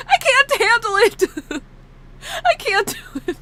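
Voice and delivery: high squeal breaking into laughter; crying laughing. Real, not acted. Streamer who must draw the tears, crying laughing. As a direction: squeal it helplessly, tearful with laughing. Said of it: "I'm crying laughing right now".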